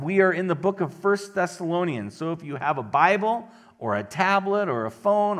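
ordinary delivery

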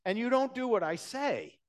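high and loud, ringing challenge; Jesus' pointed question. Acted. Jesus challenging his followers, loud and high. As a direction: fire the question at the crowd, rising. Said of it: "Jesus asked a question".

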